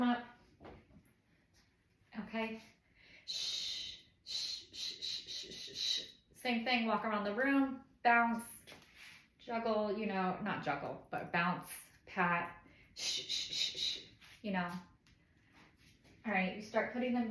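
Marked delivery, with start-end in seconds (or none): 3.3-6.0 long soft voiceless "shh"; soothing shush. Shown as a demonstration. 12.9-14.0 soothing shush. Shown as a demonstration.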